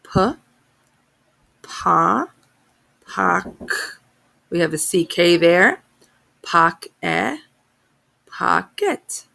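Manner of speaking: pocket built up: P, pa, pock, Pock-e, pocket; sounding out words. Shown as a demonstration. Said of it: "Let's sound it out".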